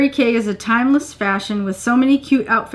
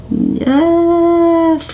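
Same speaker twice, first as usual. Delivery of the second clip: excited cheer; 7 semitones higher, 4 dB louder than usual. Real, not acted. Cheering at socks.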